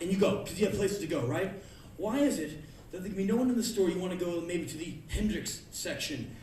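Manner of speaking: normal talking voice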